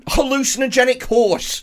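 angry outburst. Acted. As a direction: yell it in outraged disbelief. Acted outburst at cheating girlfriend.

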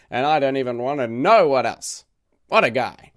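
half-joking disbelief; exasperated awe. Real, not acted. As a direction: marvel at the madman's output. Exasperated awe at prolific author.

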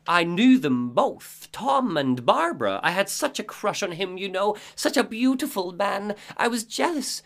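put-on high, odd old-lady voice; Cynthia voice. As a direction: greet him like a long-awaited guest. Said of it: "Well, she says".

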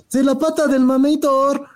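hammed-up eager fan; mock fan voice. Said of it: "y dicen".

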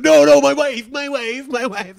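high, loud protesting voice; insist, high and loud; mock defensive guy. Coworker loudly protesting about his wife.

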